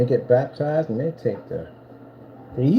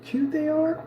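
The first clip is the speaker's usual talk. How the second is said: high cooing voice; sympathetic aww; slower than usual. Real, not acted. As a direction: coo lovingly at the pet. Cooing to the cat.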